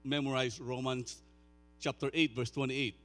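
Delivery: high, loud, fervent preaching; preaching at full force. Acted. Pastor preaching Romans eight loudly to the congregation. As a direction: drive the promise home to the room.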